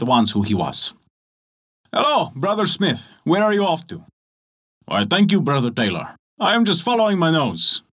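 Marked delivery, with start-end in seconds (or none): 1.9-4.0 tailor's greeting. Acted. 4.9-7.8 higher, lightly voiced young smith answering; the smith replies cheerfully to the tailor; smith's reply.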